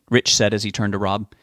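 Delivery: ordinary delivery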